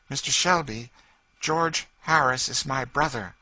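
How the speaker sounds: high, loud proud lady; proud lady's declaration. Acted. Lady telling George who she is, high and proud. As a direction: declare it with pride through tears.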